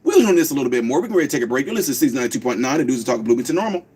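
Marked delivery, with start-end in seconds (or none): 1.7-3.8 quick, lower radio announcer read; station ID. Acted. Host reads the station ID before the break. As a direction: rattle off the station ID.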